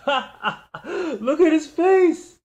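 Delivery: very high, loud, slow words through laughter; mocking the rookie's terrified face, cracking up; laughing hysterically. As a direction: howl it out between laughs. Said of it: "laughing, repeating".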